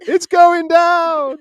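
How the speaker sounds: hammed-up high drawn-out wail of doom; slow exaggerated disaster cry; mock sinking cry. Chat comment read as a dramatic sinking wail. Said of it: "he's going".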